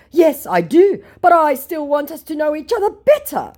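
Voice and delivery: high, loud put-on voice; hammed-up eager plea; pleading scammer. Scammer wants to know her better, high mock-pleading voice. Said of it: "To which he says".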